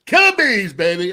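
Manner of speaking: loud, high cheer of the team name; pumped-up team cheer to sign off; hype shout. Real, not acted. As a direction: shout it out like a fan.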